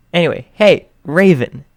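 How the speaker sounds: teasing him with his bird nickname; excited call. A narrator's reading. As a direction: greet him loudly by his new name.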